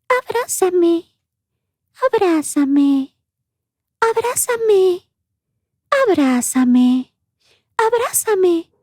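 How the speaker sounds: put-on eerie high-pitched baby plea, long drawn out; high ghostly child calling slowly; faint baby voice. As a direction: put on a thin, creepy infant voice. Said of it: "en una voz muy tenue".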